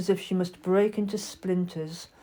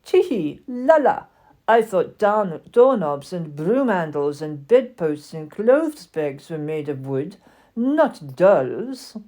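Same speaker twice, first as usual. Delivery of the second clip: louder taunting with French airs; mocking; 5 dB louder, slower than usual. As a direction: scoff at her, giggling, like a snooty French doll. French doll mocks wooden doll.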